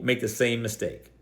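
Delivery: ordinary talk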